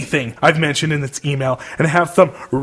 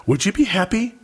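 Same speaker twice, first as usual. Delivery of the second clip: usual speaking voice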